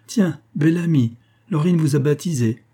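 high, lively note of amused surprise; amused exclamation. A narrator's reading. Madame de Marelle reacting to her daughter's nickname, amused exclamation. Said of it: "se mit à rire".

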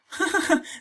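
very high little giggle; the log's tiny voice giggling. Acted. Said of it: "gli disse ridendo". (risatina acutissima della vocina)

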